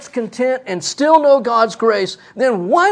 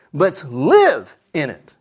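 "live" drawn out and lower; a slow "live in it" with "live" leaned on; stress on live; 6 semitones lower, slower than usual. Shown as a demonstration. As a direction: bring "live" down and hold it. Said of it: "the emphasis is live".

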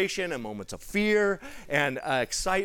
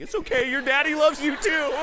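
high, loud, laughing through the words; laughing reassurance; 11 semitones higher, 7 dB louder than usual. Retold or imitated. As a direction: comfort her while cracking up. Teasing the crying nurse.

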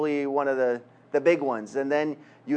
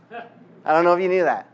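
louder, laughing through the repeated aside; teasing laugh; 2 semitones higher, 5 dB louder than usual. Real, not acted. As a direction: tease the congregation with a chuckle.

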